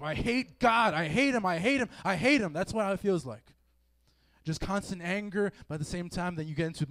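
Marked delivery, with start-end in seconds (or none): 0.0-2.5 higher, louder furious repeated hate; hateful rage. Acted. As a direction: spit out hatred at God. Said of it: "you're thinking to yourself".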